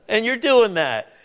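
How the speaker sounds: laughing through the words; chuckle, then voice the protest through it; amused disbelief. Acted. Preacher voicing David's thought to the congregation, laughing in disbelief.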